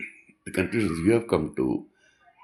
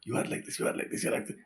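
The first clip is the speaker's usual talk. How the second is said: mock guru scolding; 7 dB quieter, much faster than usual. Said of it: "shouting all the time".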